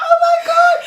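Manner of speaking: very high, loud delighted cry; thrilled squeal. Retold or imitated. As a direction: squeal it with joy. Thrilled baby has hair.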